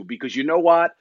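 ordinary delivery

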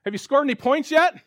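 heckling yell. Retold or imitated. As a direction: taunt him from the sidelines. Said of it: "I yell from the sidelines".

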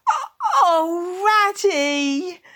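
high wail sinking in despair. Acted. Mole turns to Ratty, despairing wail. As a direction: wail for help, drawing it out. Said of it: "he cried in despair".

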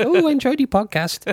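high, far-off mocking impression of her; lightly imitating a bored woman; mock listener voice. Mimicking an unimpressed listener.

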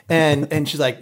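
normal talking voice

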